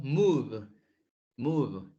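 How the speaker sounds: "move" ending in a buzzing voiced v; "move" with a voiced final v. Shown as a demonstration. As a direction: let the v in "move" vibrate. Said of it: "sí vibra cuando digo move".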